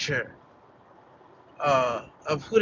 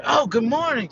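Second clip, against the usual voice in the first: bright cheerful good morning, pitched up; jubilant greeting; 6 semitones higher than usual. Retold or imitated. Coworker cheerily greets his cold supervisor, mock jubilant. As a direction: greet him like a happy person. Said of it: "I guess I'm jubilant".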